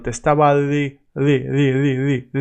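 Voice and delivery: di- repeated with the tongue touching nothing; soft di. Shown as a demonstration. Teacher drilling the soft d of diciendo. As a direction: stutter di-, di- with a hovering tongue. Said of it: "I'm not doing it neither".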